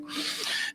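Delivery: long breathy sigh, acted out; imitated heavy sigh. Fidgeting dad across from his son, imitated sigh. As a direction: mimic dad letting out a sigh. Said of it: "and he's sighing".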